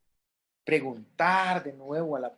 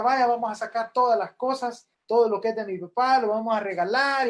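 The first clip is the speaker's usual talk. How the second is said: higher, louder brisk mock relative voice; hasty relative; 6 semitones higher, 4 dB louder than usual. Son hurrying the widow to give away father's things, lightly mocking. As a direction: play the eager family member rushing things.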